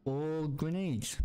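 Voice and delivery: slow drawn-out deadpan. Real, not acted. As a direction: draw it out for a joke. Drawn-out joke.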